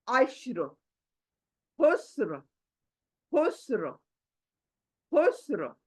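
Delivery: खुस्र ending on a thick र; heavy ra. Shown as a demonstration. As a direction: model खुस्र, र full. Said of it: "र मोटी". (खुस्र में र मोटी)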